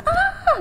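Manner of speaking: short high yell of frustration; frustrated yell. Retold or imitated. Mother yelling in frustration at home. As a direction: let out a sharp high "Ah!". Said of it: "cuman kayak teriak".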